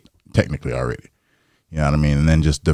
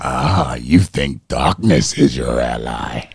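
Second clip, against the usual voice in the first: slow, lower villain voice; Bane; 4 semitones lower, much slower than usual. Acted. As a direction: draw it out as a menacing film villain. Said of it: "I can do Bane".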